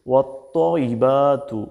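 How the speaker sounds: slow "ওয়াত্তাইবাতু" with rounded, heavy "tto"; heavy তোয়া. Shown as a demonstration. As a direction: draw out "ওয়াত্তাইবাতু" as wat-toyyi-baa-tu. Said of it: "এখানে তোয়া দিয়ে বলতে হবে". (ভারী তোয়া দিয়ে টেনে উচ্চারণ)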